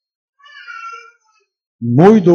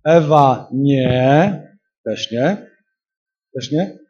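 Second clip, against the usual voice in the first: slow mock rejection; 2 semitones lower, slower than usual. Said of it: "Duch Święty przychodzi i mówi tak".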